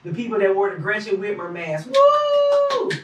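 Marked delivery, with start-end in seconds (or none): 1.9-2.9 very high, loud whooping cheer; imitated cheer. Mocking the mask-wearing crowd with a loud high whoop. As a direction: mimic the crowd cheering wildly. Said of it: "They were super excited".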